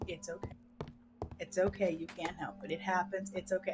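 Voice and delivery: calm him down gently; lightly imitating husband's quiet comfort; soft reassurance. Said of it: "He goes".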